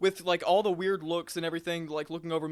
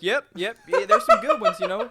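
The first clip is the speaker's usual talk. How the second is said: high, loud and laughing, egging him on; cackling agreement, way up high; eager boyfriend, laughing; 8 semitones higher, 10 dB louder than usual. Retold or imitated. Boyfriend eggs on the cashier, high laughing yep-yep. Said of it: "He is nodding like".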